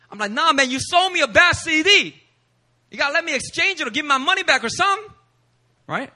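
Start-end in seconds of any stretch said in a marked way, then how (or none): 0.3-5.1 higher, louder and fast, demanding a refund; raised voice. Retold or imitated. The preacher arguing with the seller over a bad CD, raised voice. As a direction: push back hard, getting heated. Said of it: "raising my voice".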